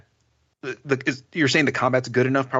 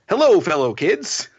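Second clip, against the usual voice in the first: put-on sing-song, slow, higher "fellow kids" parody; slow, higher mocking delivery of an out-of-touch slogan; mock youth-pandering voice; 9 semitones higher, 4 dB louder, slower than usual. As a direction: ham up the cringey corporate cool voice. Mocking pandering game dialogue.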